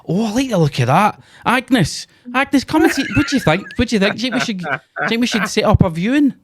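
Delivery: high, excited mock rich husband; posh viewer. A friend mocks a rich viewer calling Agnes, high and hammed-up.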